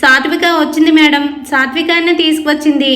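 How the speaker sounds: plain reading voice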